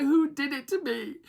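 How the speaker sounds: very high, indignant mimicry; screech-like demand in mock outrage; mock kicking off. Everyone demanding who picked them, mock kicking off. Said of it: "everyone's kicking off like".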